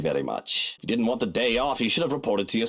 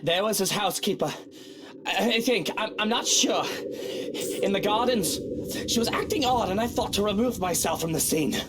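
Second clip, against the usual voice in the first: higher, stammering and unsettled; shaken stammer; 7 semitones higher than usual. Acted. Lord telling the butler about the woman, shaken stammer. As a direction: stumble over the words, still rattled.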